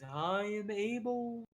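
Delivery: lightly voiced odd little creature; creature character. Little creature introduces himself to an adventurer, in character.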